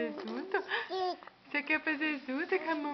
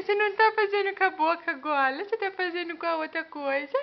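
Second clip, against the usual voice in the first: usual speaking voice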